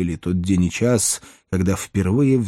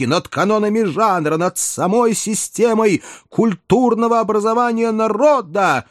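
outraged outcry; 8 semitones higher than usual. Retold or imitated. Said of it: "в полный голос вопиют знающие люди".

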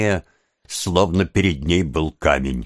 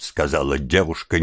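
ordinary delivery, nothing marked

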